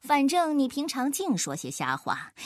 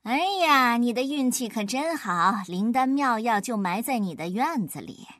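plain reading voice

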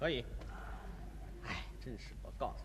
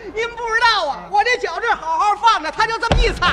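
higher, louder aggrieved griping; loud complaint; 6 semitones higher, 6 dB louder than usual. Acted. Passenger complains loudly to the conductor about his foot.

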